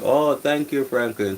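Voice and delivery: excited thanks. Real, not acted. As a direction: burst out with excited gratitude. Thanking a chat member.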